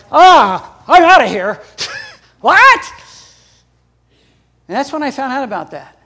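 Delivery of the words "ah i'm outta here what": loud, hammed-up cry of disbelief; comic freak-out, much louder and higher; mock shock. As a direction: yell in alarm, leaping high on the last word. Acts out shocked reaction in seminary.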